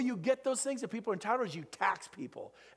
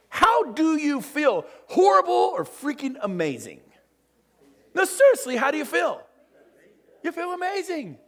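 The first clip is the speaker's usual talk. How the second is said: hype shout; 7 semitones higher, 7 dB louder than usual. Acted. Speaker firing up the audience, loud hyped shout. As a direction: pump the room up. Hyping the crowd.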